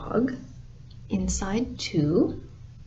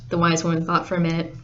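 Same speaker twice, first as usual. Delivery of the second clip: ordinary delivery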